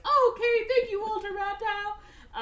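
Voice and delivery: put-on grateful kids. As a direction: thank the coach like a small child. Kids thanking the coach.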